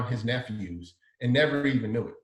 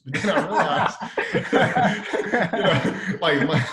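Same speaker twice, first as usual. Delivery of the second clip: laughing out loud, higher and louder; 4 semitones higher, 6 dB louder, slower than usual. Real, not acted. Designer laughing at how Jordan's warning confused him. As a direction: burst into laughter mid-sentence.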